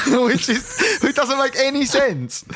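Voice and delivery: high-pitched, laughing disbelief; amused. Real, not acted. Cracking up at his own story.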